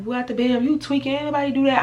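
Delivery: high mocking voice of the sister; mimicked sister. Sister's shocked retort, mimicked.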